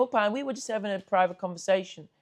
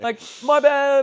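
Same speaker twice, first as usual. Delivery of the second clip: higher, louder mock-sheepish apology; hammed-up apologetic stranger; 7 semitones higher, 7 dB louder, slower than usual. Stranger apologizes to the lads, hammed-up and higher. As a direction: overdo the sorry like a reformed tough guy.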